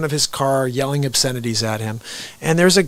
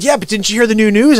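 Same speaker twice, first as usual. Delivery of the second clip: higher, louder mock-breaking-news tease; joking; 7 semitones higher, 6 dB louder than usual. Man teasing an older neighbour about news, joking. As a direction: play up a joke to a stranger. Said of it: "I jokingly said to him".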